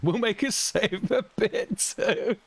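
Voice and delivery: giggling the words out high; laughing. Real, not acted. Streamer laughing at his own dark joke. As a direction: laugh through the line.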